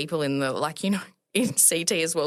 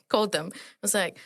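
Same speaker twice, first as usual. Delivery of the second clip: ordinary talk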